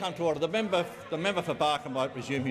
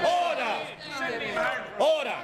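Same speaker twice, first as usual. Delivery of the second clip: shouting order order; 9 semitones higher than usual. Real, not acted. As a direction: bellow over the rowdy House. Speaker shouts over rowdy chamber.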